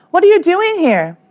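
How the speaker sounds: startled at him showing up; surprised family. Acted.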